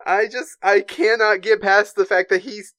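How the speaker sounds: ordinary delivery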